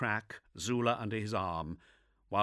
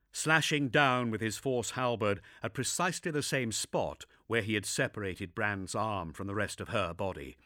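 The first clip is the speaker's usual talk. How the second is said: usual speaking voice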